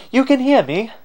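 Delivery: very high, startled question; high-pitched spirit amazed to be heard; surprised spirit. Acted. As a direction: squeak it out in astonishment. Voicing the surprised wind spirit.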